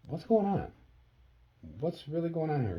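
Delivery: high, loud retold questioning; urgent demand. Son calling mom back for answers, urgent demand. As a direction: press for an answer, pitched well up.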